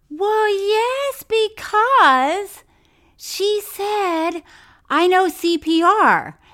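loud, high and heated; yelling. Real, not acted. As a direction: shout it in exasperation. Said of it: "Don't you yell at me".

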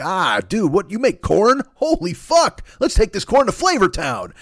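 high, excited mock Guy Fieri; over-the-top food-show host voice; Guy Fieri impression. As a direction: hype it up way high like a TV host finding the best food ever. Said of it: "He's just like".

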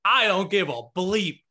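hammed-up defiant swear at the ref; mock coach outburst. Coach quoted cursing at the ref, mock defiant and slow. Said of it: "She goes".

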